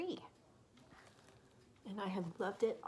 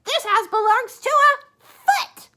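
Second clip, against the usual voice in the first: high, loud snap; Missus Bird scolding; 22 dB louder, slower than usual. Acted. Missus Bird correcting her husband, high and loud. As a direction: tell him off sharply.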